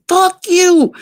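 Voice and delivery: high-pitched mock cursing; retold angry retort. Her retort in retold quarrel.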